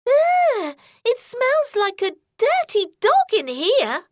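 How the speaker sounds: put-on disgusted girl. A narrator's reading. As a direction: wrinkle your nose at the smell.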